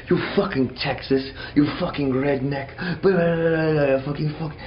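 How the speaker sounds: put-on sputtering French-accented insults; angry French accent. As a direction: ham up an angry drunk Frenchman. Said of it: "it's like a French dude".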